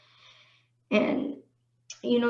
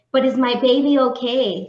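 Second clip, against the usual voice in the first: louder, pressing retold demand; desperate plea; 7 dB louder than usual. To the silent technician, a mildly raised desperate plea. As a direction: push the question out in alarm. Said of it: "I was already losing it".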